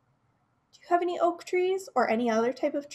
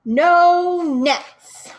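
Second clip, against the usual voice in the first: defiant shout; 5 semitones higher, 10 dB louder than usual. Acted. Birds defy the angry man.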